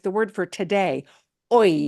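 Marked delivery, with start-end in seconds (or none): 1.5-1.9 "oi" with no H sound at all; silent H. Shown as a demonstration. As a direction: start "oi" straight on the vowel. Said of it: "you won't hear a sound".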